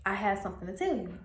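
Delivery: ordinary delivery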